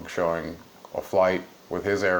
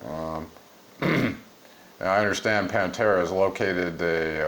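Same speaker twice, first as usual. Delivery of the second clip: ordinary delivery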